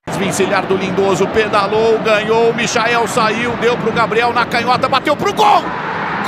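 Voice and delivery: loud driving commentary; live play-by-play. Real, not acted. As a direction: build it to the shot.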